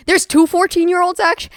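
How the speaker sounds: high-pitched burst of surprise; startled self-correction; sudden realisation. Real, not acted. Sudden realisation mid-ramble.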